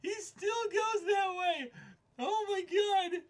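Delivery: laughing shout. Real, not acted. Cracking up at what the character still does, laughing shout. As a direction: howl it through laughter.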